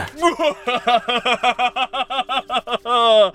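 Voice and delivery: amused chuckle. Real, not acted. Narrator chuckles.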